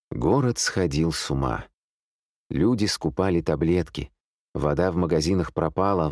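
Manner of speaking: plain reading voice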